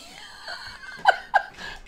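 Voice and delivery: high, airy giggle at her own joke; real embarrassed giggle; breathy high giggle. Real, not acted. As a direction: let out a breathy, squeaky laugh. Laughs at her own cheeky joke.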